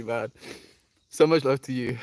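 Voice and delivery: ordinary delivery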